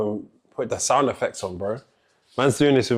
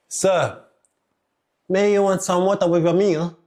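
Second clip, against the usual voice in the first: waiter voice; 6 semitones higher than usual. Acted. Plays waiter serving a customer.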